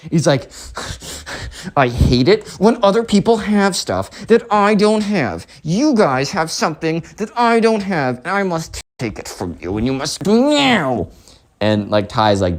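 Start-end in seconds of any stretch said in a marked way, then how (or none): 1.8-11.1 high, theatrical demanding rant; bratty spoiled kid. Acted. Spoiled kid demands what other people have, bratty.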